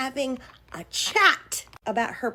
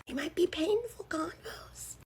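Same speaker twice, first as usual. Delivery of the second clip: sly whisper; 5 semitones higher, 8 dB quieter than usual. Acted. Sly threat of "convos.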